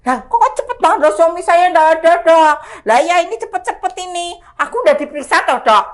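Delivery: high-pitched, flustered pleading, re-enacted; flustered protest. Patient to doctor, husband away, flustered protest. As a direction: protest in a comic panic.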